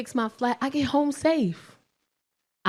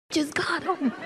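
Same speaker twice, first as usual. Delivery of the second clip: quiet repeat; 4 semitones higher, 7 dB quieter, slower than usual. Real, not acted. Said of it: "quiet repeat".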